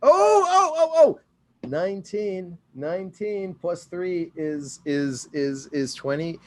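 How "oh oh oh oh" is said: high, loud thrilled yelps; can't contain the joy at a great roll; excited yelps. Real, not acted. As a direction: player thrilled at a natural nineteen.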